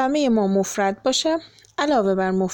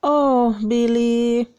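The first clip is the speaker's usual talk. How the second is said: voicing Rosie; 3 dB louder, much slower than usual.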